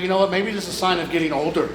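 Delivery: ordinary talk, nothing marked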